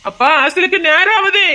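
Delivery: put-on high-pitched child's call to a parent. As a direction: call out to hurry them along. Child calls parents to get going.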